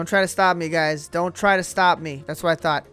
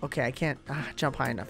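ordinary talk

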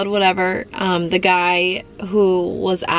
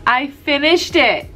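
slow, higher, emphatic announcement of success; weary triumph; 5 semitones higher than usual. Real, not acted. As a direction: draw out FINISHED, relieved and proud. Repeats it in relieved triumph.

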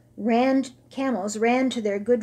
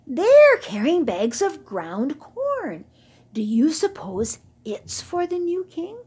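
put-on young camel; 7 semitones higher, 4 dB louder than usual. Youngest camel asking Hashmakaka, high and curious. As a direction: point it out excitedly.

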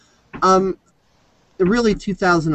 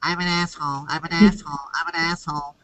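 holding nose; 5 dB quieter, faster than usual. Retold or imitated. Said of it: "hold your nose like this and say".

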